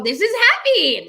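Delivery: high, loud overdone happiness; bad-actor happy. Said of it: "they just go".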